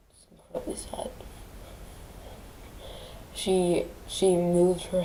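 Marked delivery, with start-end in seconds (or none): 0.1-1.1 breathy grieving whisper. Real, not acted. Grieving granddaughter whispers to viewers. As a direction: barely voice it, heavy with grief.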